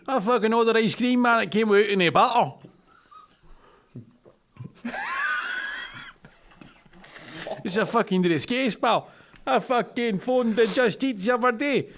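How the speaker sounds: broad Scottish ranting bloke; Scottish accent. Pretend. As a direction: rant about the ice cream in a thick Scottish accent. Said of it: "how good you are at accents".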